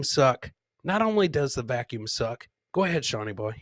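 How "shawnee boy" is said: usual speaking voice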